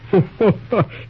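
short, amused laugh at uncle's remark; a quick fond chuckle. Acted.

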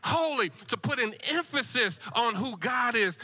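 high, ringing sermon delivery; exultant church preacher; full-force preaching. Real, not acted. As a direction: lift each "holy" high like a proclamation.

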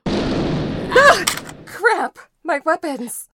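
high, loud startled yelp; startled cry. Acted. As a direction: yelp as weapons vanish. Weapons snatched away.

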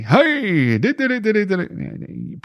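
high, hammed-up huckster patter; used car salesman. Podcaster mocking a pushy used car salesman, overhyped. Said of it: "don't be a used car salesman".

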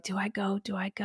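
nervous whisper to herself; quiet hesitant self-talk; hushed self-talk. Retold or imitated.

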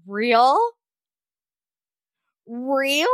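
drawn-out questioning rise, left hanging; incredulous echo. Real, not acted. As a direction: repeat it as a disbelieving question. Incredulous echo of quoted caption word.

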